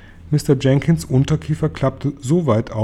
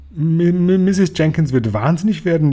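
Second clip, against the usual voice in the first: high, louder stammering wail; wailing lament; 6 semitones higher, 4 dB louder than usual. A narrator's reading. Father dreading his wife's reaction, wailing lament. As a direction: stumble in, then lament in high pitch. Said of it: "jammerte Mister Jenkins".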